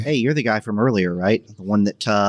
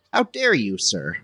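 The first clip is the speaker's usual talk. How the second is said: high, mocking how dare you; mock outrage; 8 semitones higher than usual. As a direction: parody the merchant's indignation. Player mocks merchant.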